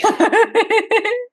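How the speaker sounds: bursting high laugh. Real, not acted. Laughing at toothbrush idea.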